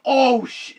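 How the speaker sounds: sudden high yelp of shock; startled curse. Retold or imitated. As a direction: cut off mid-curse in fright. Shock at hitting the dog.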